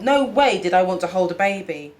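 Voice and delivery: ordinary delivery, nothing marked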